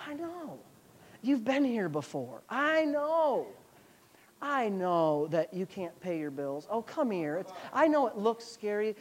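soft, low mock-soothing Jesus, drawn out; sarcastic coddling. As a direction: coo as if comforting poor frightened little boys. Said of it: "I'm sarcastic at times".

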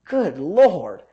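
ordinary talk, nothing marked

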